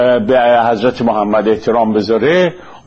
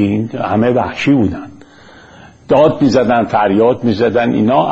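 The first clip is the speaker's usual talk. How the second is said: ordinary talk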